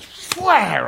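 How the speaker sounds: sharp, high mock hiss-strike "Fwah!"; a baby rattler lunging at him; imitated snake strike.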